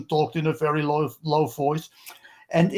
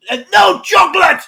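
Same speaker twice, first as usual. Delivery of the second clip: loud, high barked order; forceful command; 12 semitones higher, 10 dB louder than usual. Shown as a demonstration. Showing a forceful customer order, loud and high. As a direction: bark it as a hard command. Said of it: "if you go".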